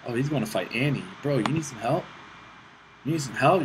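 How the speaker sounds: normal talking voice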